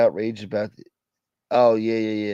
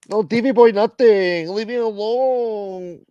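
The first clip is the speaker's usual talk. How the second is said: mock whiny voice; 9 semitones higher, slower than usual. Mocking someone's voice.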